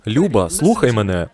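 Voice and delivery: normal talking voice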